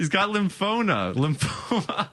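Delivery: high voice breaking into laughter; laughing protest. Real, not acted. Host answers co-host's tremor joke, laughing protest. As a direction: squeeze the words out while cracking up.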